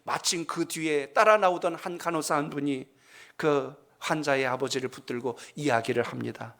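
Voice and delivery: ordinary delivery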